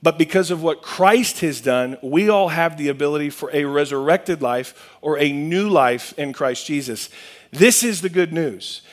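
usual speaking voice

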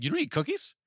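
mock the woman's shock; incredulous customer. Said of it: "And she's like".

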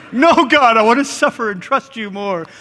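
mock pious whine, pushing back on God; whiny martyr. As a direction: beg to suffer like a self-pitying martyr.

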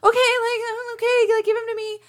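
high-pitched retold reassurance; mock high reassuring voice. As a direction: squeak it out like a flustered new mom. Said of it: "And I'm like".

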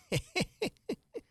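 high amused giggle; a little girl laughing at her own silliness; short bright giggle; girlish laugh. Acted. Alice laughs at her idea.

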